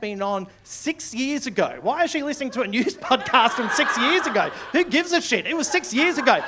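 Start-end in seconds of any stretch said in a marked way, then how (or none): 1.8-6.4 high-pitched, incredulous comic protest; exasperated disbelief. Acted. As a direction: protest as if it's absurd. Comic outrage at the complaint.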